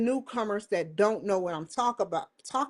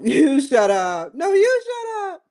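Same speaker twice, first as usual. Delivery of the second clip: 1 mock argument with myself; 8 semitones higher, 7 dB louder than usual. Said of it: "I would be like".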